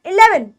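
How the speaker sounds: इलेवन with a quick "ih" opening; इलेवन said short and compact; short first vowel. Shown as a demonstration. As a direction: keep the इ in इलेवन brief. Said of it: "short करके बोलना है".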